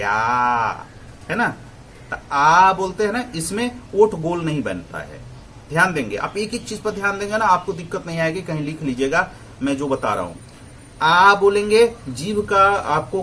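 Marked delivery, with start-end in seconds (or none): 0.0-0.7 आ held long with the mouth wide open; खुले मुंह का स्वर. Shown as a demonstration. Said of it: "मुंह पूरा खोलेंगे". 11.0-11.3 आ loud and high, from the back of the tongue; open back vowel. Shown as a demonstration. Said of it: "जीभ का सबसे पिछला हिस्सा".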